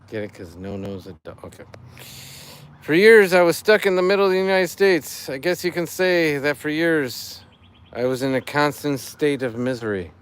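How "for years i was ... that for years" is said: higher, louder corny setup; dad joke voice. Acted. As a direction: lean into the pun like a proud dad. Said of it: "I'm doing it in dad joke voice".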